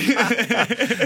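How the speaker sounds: laughing. Real, not acted. Guest laughs at fat kid joke.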